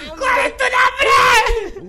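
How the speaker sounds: high, drawn-out shout. Pretend. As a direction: stretch the yell out slowly. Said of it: "lo voy a gritar".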